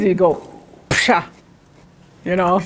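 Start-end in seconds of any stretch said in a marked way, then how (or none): 0.9-1.2 "psha" blown out like a sneeze; mock sneeze; sneeze sound. Shown as a demonstration. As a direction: imitate a sneeze. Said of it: "if you sneeze".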